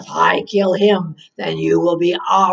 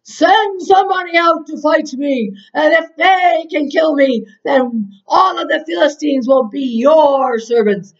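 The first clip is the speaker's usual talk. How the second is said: Goliath's shout; 3 semitones higher, 4 dB louder than usual. Acted. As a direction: shout a giant's challenge to a whole army. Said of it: "Goliath would come out and shout".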